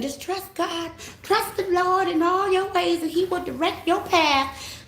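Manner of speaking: high, slow, sugary churchy preaching voice; high, slow mocking of a churchgoer quoting scripture.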